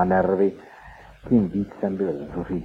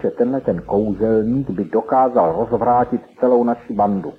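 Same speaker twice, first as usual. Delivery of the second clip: plain reading voice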